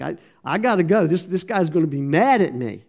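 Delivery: put-on a scared boy trying to pull away; hurried frightened kid voice; panicky boy. Boy tugging at hand, scared.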